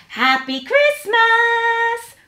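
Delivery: high, drawn-out festive call; joyful cry. A narrator's reading. Mouse greeting Hedgehog at the door, joyful drawn-out cry. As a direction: call it out high and slow, stretching the words. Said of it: "cried Mouse".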